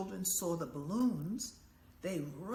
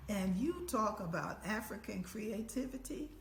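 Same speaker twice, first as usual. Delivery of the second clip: ordinary talk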